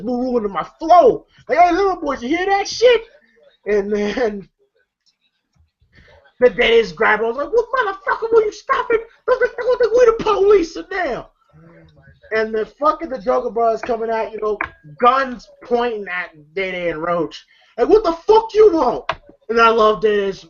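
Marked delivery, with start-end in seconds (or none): 1.6-3.0 high-pitched mock menace; taunting challenge. Voicing Joker confronting the boys. 7.5-11.3 very high, agitated imitation; frantic protest. Acting out a frantic scuffle. 17.9-19.0 high, forceful mock confrontation; hostile demand. Voicing armed brothers' confrontation.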